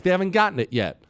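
ordinary talk, nothing marked

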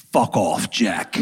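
put-on low, dry English accent brush-off; mock English-accented snub; Daniel Day-Lewis accent. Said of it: "in his accent".

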